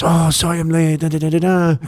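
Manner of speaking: mimicking someone's apology in a put-on voice. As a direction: imitate a late arrival, trailing into da-da-da filler. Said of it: "his imitation is part of the story".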